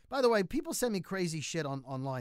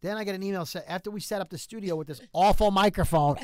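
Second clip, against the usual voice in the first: usual speaking voice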